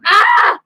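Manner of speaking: loud, high sudden cry; startled yelp. Real, not acted. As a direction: yelp as you touch the wet paint. Kid hits wet paint.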